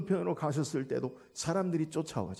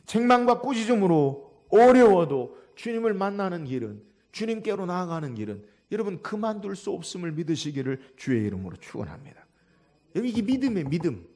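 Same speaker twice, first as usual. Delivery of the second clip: normal talking voice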